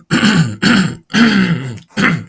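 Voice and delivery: long rough clearing of the throat; loud throat clearing. Real, not acted. Narrator reading his life story clears his throat loudly. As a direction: hack the throat clear before going on.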